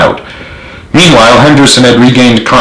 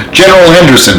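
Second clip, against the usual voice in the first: cried out; 7 semitones higher, faster than usual. A narrator's reading. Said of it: "Hicks cried".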